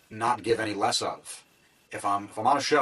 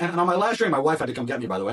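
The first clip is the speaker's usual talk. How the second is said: usual speaking voice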